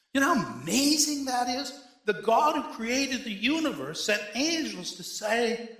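full-voiced pulpit proclamation; fervent preaching. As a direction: drive the good news home to the congregation. Preaching with rising fervor.

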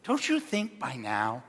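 ordinary talk

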